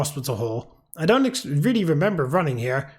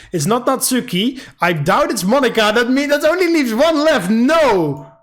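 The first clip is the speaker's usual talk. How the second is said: higher and louder up to a shouted no; rising panic; 6 semitones higher, 6 dB louder than usual. Real, not acted. Player realizing it must be Sayori, panicked rising shout. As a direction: panic building to a cry.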